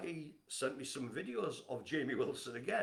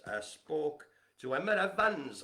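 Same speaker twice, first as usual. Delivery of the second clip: put-on a slow, louder Welsh accent on "Emma Evans"; 3 semitones higher, 7 dB louder than usual. Host greets Emma Evans in Welsh accent. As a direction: drawl the name out in a Welsh accent. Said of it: "have to do the Welsh accent".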